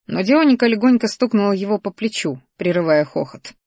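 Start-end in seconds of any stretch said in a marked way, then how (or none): none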